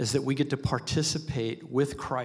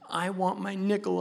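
put-on whiny kid voice; 8 semitones higher than usual. Child insisting.

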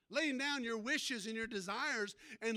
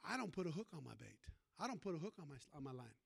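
hushed, quicker secret; softer confiding aside; 7 semitones lower, 8 dB quieter, faster than usual. Acted. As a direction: lean in and let them in on it. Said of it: "I'm gonna tell you a little secret".

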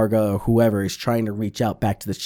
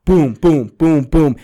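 mock blaster booms; 3 semitones higher, 4 dB louder, slower than usual. Imitating blaster fire.

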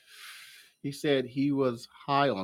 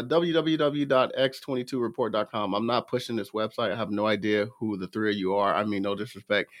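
ordinary delivery

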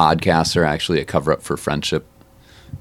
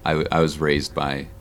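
usual speaking voice

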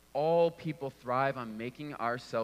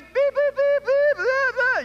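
high, loud horn beeps then blah blah; imitated angry honking; 9 dB louder, slower than usual. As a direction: mimic her leaning on the horn, high and loud. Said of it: "she does one of these".

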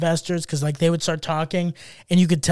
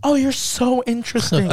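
higher, gushing imitation of impressed women; mock fawning girls; 5 semitones higher than usual. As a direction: lightly act out flattering girls. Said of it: "these girls are like".

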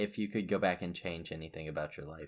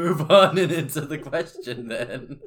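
laughing; 6 semitones higher, 12 dB louder than usual. Real, not acted. Laughing while bantering.

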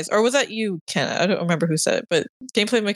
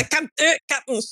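playful, raised Scottish imitation; mock Scottish accent; 6 semitones higher, faster than usual. Joining in on the Star Trek line, mock Scottish accent. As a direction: echo the bad Scottish bit, amused. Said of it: "do a Scottish accent".

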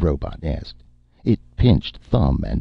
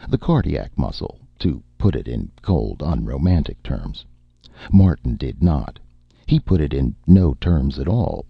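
ordinary delivery

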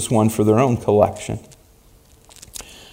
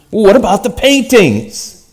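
indignant protest; 11 semitones higher, 4 dB louder than usual. Acted. Bidder protesting auction end.

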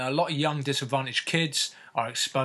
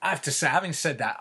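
ordinary delivery

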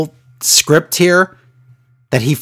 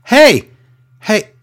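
lightly voiced eager wrestler, higher and louder; a hint of a hyped-up heckler; chirpy mock call-out, pitched up; mock excited Byron; 8 semitones higher, 5 dB louder than usual. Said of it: "He goes".

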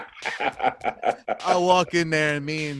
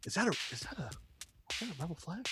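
very soft, higher-pitched imitated question; hushed disbelief; 4 semitones higher, 13 dB quieter, much faster than usual. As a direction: ask it under your breath, imitating a stunned friend. Friend's stunned question, retold very softly.

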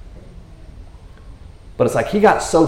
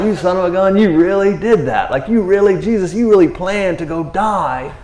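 higher, louder amazed praise; 5 semitones higher, 4 dB louder than usual. Retold or imitated. Disciples marveling at Jesus' plan, higher and louder.

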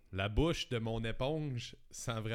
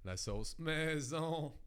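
slow punchline; 5 semitones higher, much slower than usual. Acted. Slow joke punchline delivery.

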